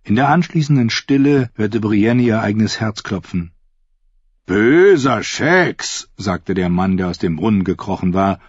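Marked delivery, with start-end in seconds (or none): 4.5-6.1 slow, drawn-out mock scolding; mocking reproach. A narrator's reading. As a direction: tease him like a dog. Timeon scolds the fool mockingly. (langsamer spöttischer Tadel)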